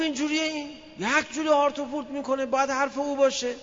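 high mocking wife voice; scornful wife, pitched well up; imitating the wife. Wife mocking her husband's bluster, high scornful voice.

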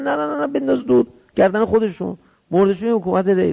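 high, quick-starting string of refusals; urgent dissuading. Retold or imitated. Friends urge him to drop Rey, dissuading. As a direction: imitate friends hastily waving the offer away.

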